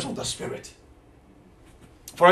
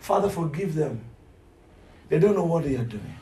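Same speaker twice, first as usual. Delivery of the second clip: softer, lower, understated quote; usual normal voice; 4 semitones lower, 7 dB quieter, faster than usual. Acted. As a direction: speak it gently, as an everyday human voice. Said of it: "Usual normal voice".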